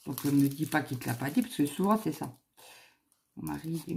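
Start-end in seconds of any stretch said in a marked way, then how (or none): none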